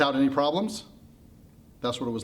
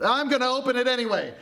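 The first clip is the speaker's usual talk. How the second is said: sudden high-pitched defiance; defiant outburst; 10 semitones higher than usual. Acted. Pandora defying everyone's warning, defiantly. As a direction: blurt it out high after a lull.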